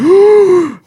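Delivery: loud, high acted gasp; big exaggerated gasp; mock gasp. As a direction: gasp as an amazed crowd would. Everyone gasps in the retold scene.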